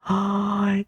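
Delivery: high quick gasp of delight. Real, not acted. Streamer seeing fan art for the first time, delighted gasp. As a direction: gasp at something adorable.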